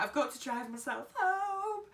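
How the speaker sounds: high, loud panicked cry; mock panic, voice jumping up. Panicking alone in the snow.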